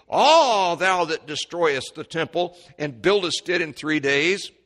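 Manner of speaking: loud, high jeering taunt; a mocking shout from the crowd; mocking rail. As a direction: jeer it at him as a scoffer passing by. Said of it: "railed on him".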